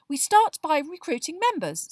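happy Hermione. A narrator's reading. Girl telling friends how the campaign starts, happy. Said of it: "said Hermione happily".

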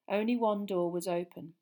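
usual speaking voice

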